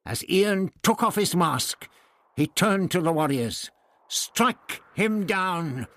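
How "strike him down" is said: high, slow, drawn-out command; commanding order. A narrator's reading. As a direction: order the attack, stretching the words. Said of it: "he ordered".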